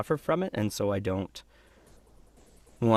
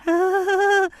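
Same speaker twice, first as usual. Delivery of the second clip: loud, high-pitched laugh; short pitched-up put-on laugh; high character laugh; 4 dB louder than usual. Shown as a demonstration. Laughs while in Mike's voice.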